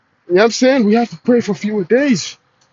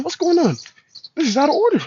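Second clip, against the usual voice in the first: mock indignation; 8 semitones higher than usual. Mock outrage at disorder.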